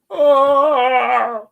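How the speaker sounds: loud high open-mouthed yawn. Real, not acted. Streamer yawning big after a chill tune, loud yawn.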